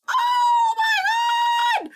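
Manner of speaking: shrieking high and loud in real horror; horrified scream. Real, not acted. Streamer screams at a trypophobia picture, horrified shriek. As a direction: recoil screaming at a gross image.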